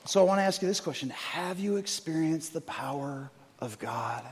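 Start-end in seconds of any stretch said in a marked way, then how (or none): 1.1-4.2 soft invitation. Acted. Intimate altar call question.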